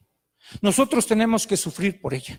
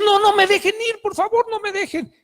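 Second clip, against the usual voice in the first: high-pitched frantic begging, loud at the start; acted-out distraught plea; anguished pleading; 11 semitones higher, 3 dB louder than usual. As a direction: beg desperately like a terrified girl. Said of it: "hecha un mar de lágrimas y angustiadísima".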